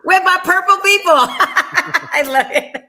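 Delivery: high, loud, cracking up; laughing wordplay. Real, not acted. Laughing wordplay on the echoed line.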